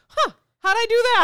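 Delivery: playful, puzzled self-quote; mock bafflement. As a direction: act out being baffled by your own mishap. Mock-baffled quote of own reaction.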